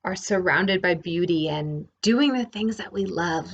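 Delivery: ordinary talk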